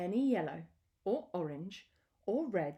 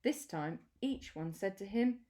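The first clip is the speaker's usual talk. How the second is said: usual speaking voice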